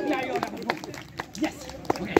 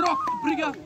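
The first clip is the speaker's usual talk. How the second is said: strained effort; 3 dB quieter than usual. Real, not acted. Straining in a backbend.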